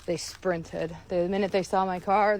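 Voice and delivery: ordinary delivery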